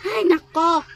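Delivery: loud, exasperated cry; shouted teacher's exasperation; exasperated outburst. Acted. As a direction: throw it out, fed up. Teacher's outburst at unruly pupils.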